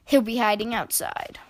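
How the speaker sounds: ordinary delivery, nothing marked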